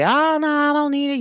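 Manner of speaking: high, put-on protest; mock denial. Mimicking people who won't admit needing release, mock denial.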